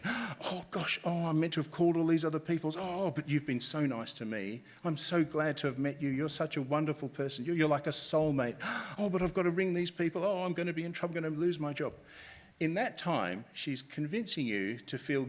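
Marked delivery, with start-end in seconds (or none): 0.0-11.9 acted fluster with gasps and a lifted pitch; flustered scam caller. Scam caller buttering up the victim on the phone, flustered. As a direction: gush and fret like a caller in a sudden panic. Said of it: "she suddenly gets flustered".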